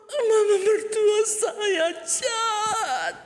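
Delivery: high, slow wailing shout; mock crying complaint. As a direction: wail it like a wronged wife. Wife crying to doctor. (mengadu sambil menangis)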